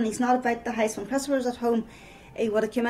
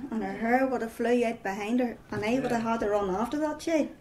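ordinary talk

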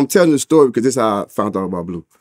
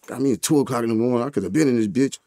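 normal talking voice

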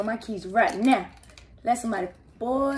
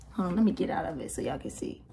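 ordinary talk, nothing marked

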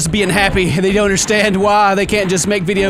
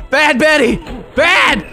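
loud, higher scold of a bad dog; mock scolding; 6 semitones higher, 3 dB louder, slower than usual.

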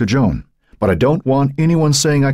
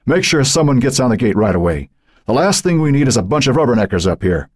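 firm police chief, pitched higher; higher, commanding voice; issuing orders; 7 semitones higher than usual. A narrator's reading. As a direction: give brisk orders over the radio. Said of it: "used his radio to issue orders".

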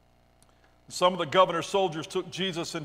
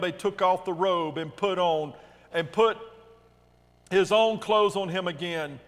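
ordinary delivery

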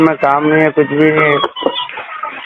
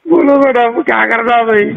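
pleading appeal; 6 semitones higher than usual. Pretend. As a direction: push the plea up and out. Caller pleads for advice.

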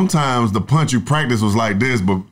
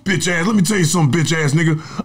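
higher, hammed-up motivational guru bragging; mock guru boasting about his routine; mock hustle guru; 9 semitones higher than usual. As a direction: play a preachy grindset influencer lecturing a slacker.

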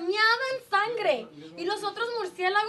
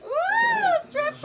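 high, loud mock-shocked whoop; excited whoop; 13 semitones higher, 7 dB louder, much slower than usual. Real, not acted. Host whooping at guest's strippers offer, excited. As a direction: whoop it up at the word.

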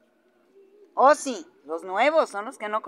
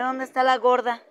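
ordinary delivery

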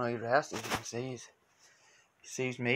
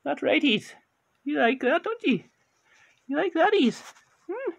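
put-on very high, loud baby-talk to the cat; high pet-talk voice; 14 semitones higher, 7 dB louder than usual. Owner cooing very high at his cat. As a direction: coo up high to get his attention.

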